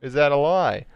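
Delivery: loud, high, hammed-up Aussie; mock Australian accent. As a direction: play a bad Aussie pitchman. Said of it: "you won't get an Australian accent".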